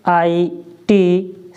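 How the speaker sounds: letters spelled slowly one by one; patient letter-by-letter spelling. Shown as a demonstration. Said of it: "सिट".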